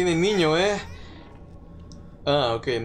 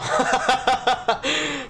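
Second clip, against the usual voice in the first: high, staccato cackle; burst of laughter; 10 semitones higher than usual. Real, not acted. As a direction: break into a high, rapid laugh. Found something, bursts out laughing.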